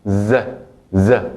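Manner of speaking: buzzing voiced z sound, drawn out; English-style z. Shown as a demonstration. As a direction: buzz a z like English. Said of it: "como la Z inglesa". (z sonora como en inglés)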